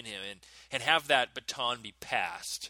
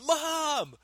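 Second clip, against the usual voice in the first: much higher, loud, slow triumphant yell; yelling for mom; 7 dB louder, much slower than usual. Retold or imitated. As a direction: crow for mom like you've won. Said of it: "So I start yelling".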